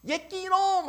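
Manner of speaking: very high, loud playful rebuke; delighted mock scolding. Flattered grandmother rebuking a well-wisher, loud mock scolding. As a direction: scold them while secretly thrilled. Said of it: "표정 막 좋아 죽어".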